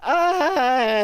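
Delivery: loud, high mock yelp of fright; imitating a victim's startled cry. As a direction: cry out like you've been stabbed.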